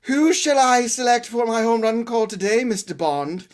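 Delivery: high, theatrical supervillain voice; Bond villain. Pretend. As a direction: toy with the hero like a movie villain. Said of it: "like, a Bond villain".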